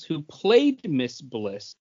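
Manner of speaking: ordinary talk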